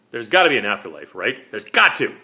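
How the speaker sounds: loud, desperate plea; pleading. A narrator's reading. Vampire pleading with the dying psychic, loud and desperate. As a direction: beg for an answer. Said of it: "he pleaded".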